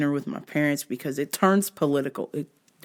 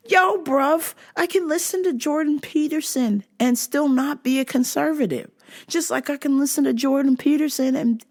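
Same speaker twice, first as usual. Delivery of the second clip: higher-pitched exasperated rebuttal; indignant protest; 7 semitones higher than usual. Retold or imitated. Adult child correcting dad, indignant comic protest. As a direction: push back at dad, pitched up.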